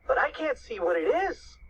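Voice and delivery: high-pitched, puzzled alarm; mildly alarmed cry. A narrator's reading. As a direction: say it high, a touch anxious. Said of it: "cried Cheeseball".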